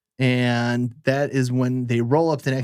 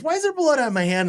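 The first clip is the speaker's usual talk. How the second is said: alarmed question; 13 semitones higher than usual. Retold or imitated. Ned realizes he's shot.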